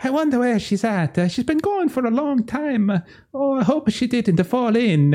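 fretting in a high bad Italian accent; high worried Italian voice. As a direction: wonder aloud, high and anxious. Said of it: "The whole time he's like".

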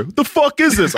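high mock yell of a fed-up father; imitating angry dad. Son voicing his dad searching for lids, high mock yell. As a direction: ham up the dad hunting lids.

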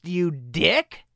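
ordinary talk

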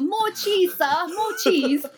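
high, pleading British urchin; mock Dickensian orphan, British accent; David Copperfield. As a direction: beg in a hammy British accent. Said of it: "you're David Copperfield".

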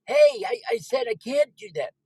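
very high, protesting voice; hammed-up squeaky fitness guru; Richard Simmons. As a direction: whine back at your tormentor. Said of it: "The Count has it in for Richard Simmons".

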